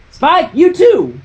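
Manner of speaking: high, loud, slow call; calling Spike. A narrator's reading. Applejack calls Spike to help, high and loud. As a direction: call him over firmly.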